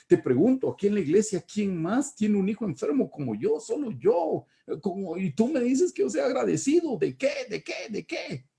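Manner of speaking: very high, pleading indignation; bitter protest. Retold or imitated. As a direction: challenge the whole church, voice climbing. Reenacting his protest to counselor.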